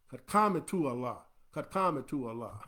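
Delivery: "Qad qamatu Allah" said as a garbled mishearing; mock wrong call; mocking wrong pronunciation. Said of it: "And you hear them say".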